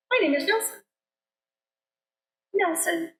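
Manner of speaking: high little mouse; squeaky mouse voice. A narrator's reading. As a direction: voice a tiny eager mouse. Mouse character introduces himself.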